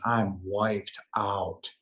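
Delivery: slow, dragged-out mock exhaustion; worn out. Answering a remark on looking tired, exhausted. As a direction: drag the words out wearily. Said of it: "You look tired".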